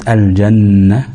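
long nasal hum on the doubled ن in الجنة; held ghunna. Shown as a demonstration. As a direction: lean on the ن of الجنة, holding it. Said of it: "هنتك على النون شوية". (مدّ غنة النون المشددة في الجنة)

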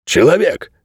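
a touch of a growling dog; displeased growl. A narrator's reading. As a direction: say it with understated displeasure. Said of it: "рыкнул овчар-переросток недовольным тоном".

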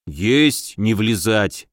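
low, strained, reluctant compliance; through gritted teeth. A narrator's reading. Soldier grudgingly accepting the boyar's order, teeth gritted. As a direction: force out the acknowledgement. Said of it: "с трудом сквозь зубы отозвался Михалёв".

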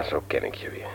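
unvoiced, pleased whisper; husband whispering happily about the hot toddy. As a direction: breathe it out contentedly. Pleased aside to wife.

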